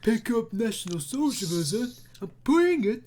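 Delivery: high, loud goofy cartoon voice; cartoon Patrick voice. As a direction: ham it up like a cartoon. Parodying the Patrick meme.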